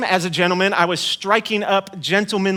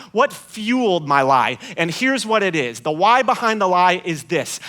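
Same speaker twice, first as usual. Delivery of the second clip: normal talking voice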